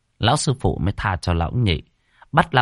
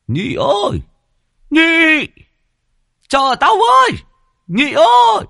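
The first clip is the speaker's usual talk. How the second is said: much higher, louder yell after a fleeing friend; calling out; 7 dB louder, slower than usual. Acted. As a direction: shout for him to wait up.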